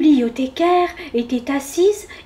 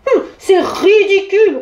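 louder, higher scornful huff; grumbling, voice raised; 7 semitones higher, 6 dB louder than usual. A narrator's reading. Bear balking at the book, raised grumbling voice. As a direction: huff and raise your voice in protest. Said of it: "rechigna-t-il en élevant la voix".

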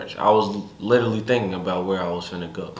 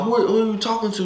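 imitating angry father; 13 semitones higher, faster than usual. Father confronting daughter, retold.

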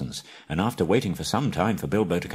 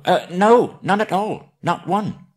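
high, squeaky little hobbit voice; frightened hobbit; 11 semitones higher, 6 dB louder, slower than usual. Acted. Bilbo blurting to the trolls, squeaky high hobbit. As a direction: pipe it up small and scared.

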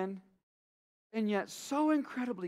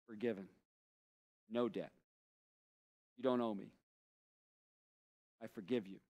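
tender forgiving; 11 semitones lower, 11 dB quieter than usual. Shown as a demonstration. Forgiving someone who wronged you, hushed and tender. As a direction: release the debt gently, word by word.

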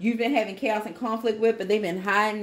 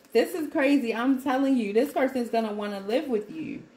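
ordinary talk

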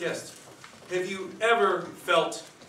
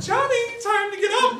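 much higher calling mom voice; mother's voice; 8 dB louder than usual. Acted. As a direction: call him awake like his mother. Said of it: "I heard my mother knocking".

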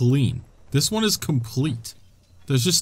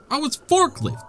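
1 excited recognition; 12 semitones higher than usual. Real, not acted. Recognises the Korok again.

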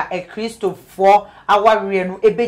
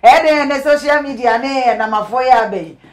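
laughing; 5 semitones higher, 7 dB louder than usual. Real, not acted. Laughing at the teasing.

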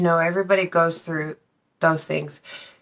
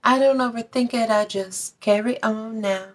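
mock celebrity quote; 4 semitones higher than usual.

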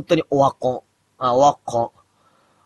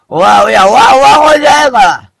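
high and loud, "オワコン" pushed out in protest; 9 semitones higher, 9 dB louder, faster than usual. Real, not acted. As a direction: shout the denial back at chat. Denying chat calls him washed up. (高く大声で「オワコンじゃない」と抗議)

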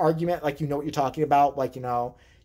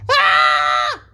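loud mock infant scream; baby scream; 9 dB louder than usual. Baby brother starting to scream again, loud mock scream. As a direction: let out a baby's piercing yell.

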